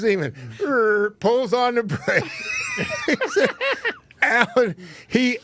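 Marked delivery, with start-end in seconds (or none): 1.2-4.2 laughing. Real, not acted. Laughing while telling.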